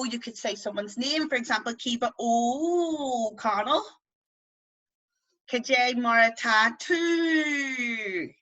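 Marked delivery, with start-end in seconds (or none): 2.2-3.8 "O'Connell" with a greatly stretched O; long O fada. Shown as a demonstration. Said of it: "And then for the O". 5.5-8.3 "tattoo" with a long, falling final OO; long fada vowels. Shown as a demonstration. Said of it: "practice them in words that you know".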